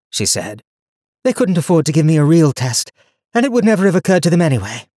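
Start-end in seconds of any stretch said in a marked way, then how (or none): 1.2-4.9 lightly voiced young heroine, higher; young woman voice. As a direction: speak her lines higher and brighter. Donia's dialogue voice.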